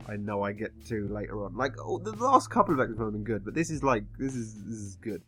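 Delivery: ordinary talk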